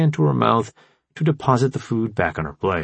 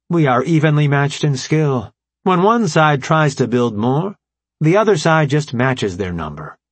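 formal AI commander, raised and emphatic; brief the allies in a higher, deliberate voice; Alpha Prime; 6 semitones higher, 3 dB louder than usual. Acted. Voices the AI leader explaining.

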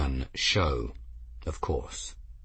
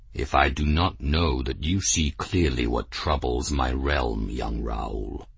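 lower, measured chief's voice; old clan chief; 7 semitones lower than usual. A narrator's reading. Clan chief weighs the stranger's words, low and steady. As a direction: speak with weighty calm authority.

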